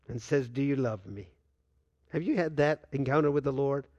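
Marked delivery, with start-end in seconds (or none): 0.5-1.3 gentle question. Acted. The Lord asks Peter gently.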